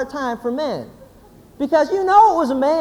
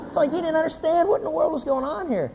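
normal talking voice

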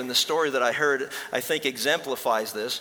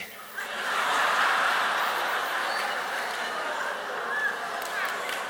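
long breathy laugh at the punchline; real laughter. Real, not acted. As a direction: laugh hard at the joke. Laughs at own joke.

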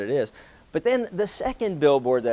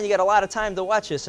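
normal talking voice

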